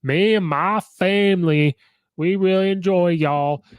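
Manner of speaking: imitating the coach's fake Southern accent to LSU fans; Brian Kelly fake Southern accent. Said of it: "he comes up with this fake accent, the Southern accent".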